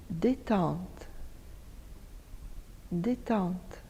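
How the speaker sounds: soft, low, spaced-out word of release; relaxation mantra. Shown as a demonstration. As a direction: let each word sink, low and hushed. Mantra de relaxation dit mentalement. (mantra de détente, doux et grave)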